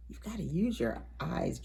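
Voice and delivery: ordinary delivery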